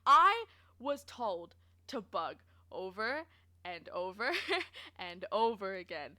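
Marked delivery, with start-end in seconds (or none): none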